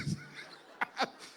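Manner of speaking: a sudden high, breathy burst of laughter; breathless laugh. Retold or imitated. Rich man laughs at the crazy introduction, breathy high laugh. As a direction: laugh it off in disbelief.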